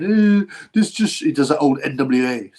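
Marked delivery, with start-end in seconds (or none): 0.0-1.2 high, loud, drawn-out mock wail; mock crying. Streamer mimicking the boxer's tearful speech, mock crying. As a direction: imitate a boxer blubbering. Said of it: "and he starts crying".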